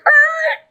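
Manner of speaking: sudden high, loud screech; mock screech. Career plan halts all of a sudden, imitated screech. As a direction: screech like an abrupt halt. Mimics sudden halt in shock.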